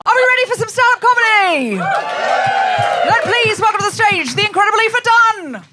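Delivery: high, loud, slow introduction; announcer voice. Real, not acted. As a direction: announce the act to the stage. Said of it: "host introduces stand-up act".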